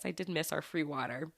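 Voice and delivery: usual speaking voice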